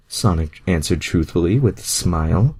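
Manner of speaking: ordinary delivery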